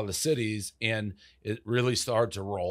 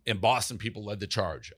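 ordinary delivery

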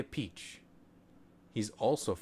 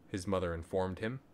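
usual speaking voice

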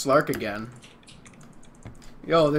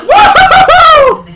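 high, drawn-out laughing whoop; whooping cheer; 17 dB louder, slower than usual. Real, not acted. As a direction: whoop in pure triumph. Celebrating the kill.

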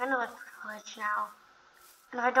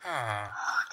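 low, soft, breathless gasp; hushed gasp; 14 semitones lower, 6 dB quieter than usual. Real, not acted. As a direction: catch your breath in wonder. Opens chest, gasps.

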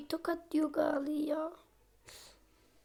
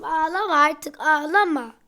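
loud pleading; 8 dB louder than usual. Real, not acted. Loudly telling baby stop crying.

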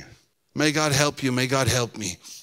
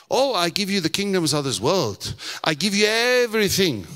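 raised, insistent tempter's offer; higher-pitched Satan making a grand offer; lightly voiced Satan; 6 semitones higher, 3 dB louder than usual. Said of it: "So here I am, Satan".

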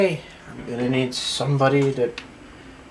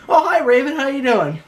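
cheery greeting; 11 semitones higher, 5 dB louder than usual. Acted. Greeting a character brightly.

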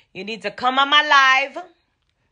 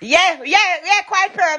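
excited approval; 8 semitones higher than usual. Real, not acted. Approving fan's exclamations.

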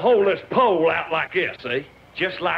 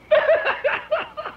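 short high-pitched laughing burst; cracking up. Real, not acted. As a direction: break into a high giggle. Laughs at his own punchline.

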